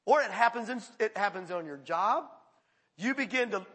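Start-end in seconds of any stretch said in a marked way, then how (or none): none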